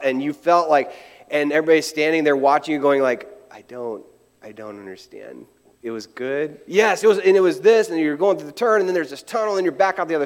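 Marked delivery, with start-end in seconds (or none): 3.5-6.6 very soft, low aside; mock bafflement. Bystanders watching the excited rider, baffled and hushed. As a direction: drop right down, puzzled and underplayed.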